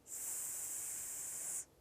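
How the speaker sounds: long hissed "ਸ" with no vowel; snake-like sustained "ਸ"; letter sound S. Shown as a demonstration. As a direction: hold "ਸ" as a steady sssss hiss. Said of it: "ਇਹਦੀ ਆਵਾਜ਼ ਹੈਗੀ ਆ".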